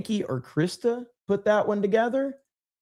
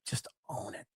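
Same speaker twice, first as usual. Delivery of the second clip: whispered, under the breath; a breathy aside, almost no voice; 19 dB quieter than usual. Real, not acted. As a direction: say it again as a hushed whisper. Repeated as a whisper.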